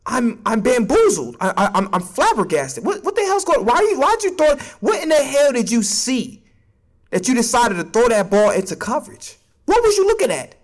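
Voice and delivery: high, fast, incredulous fan rant; exasperated fan. Retold or imitated. A fan yelling at Patrick in disbelief, high and fast. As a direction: sputter in disbelief at the quarterback. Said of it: "where you're like".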